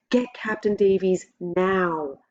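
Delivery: slow, drawn-out urgent call; urgent shout. A narrator's reading. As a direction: yell for help from the shore. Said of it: "The shout is closer".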